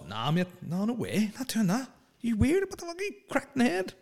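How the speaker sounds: outraged squeaky refusal, mocking; incredulous refusal.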